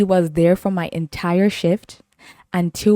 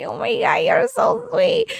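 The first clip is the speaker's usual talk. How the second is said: squealing. Retold or imitated. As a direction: gush like you're touched. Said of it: "And I was like".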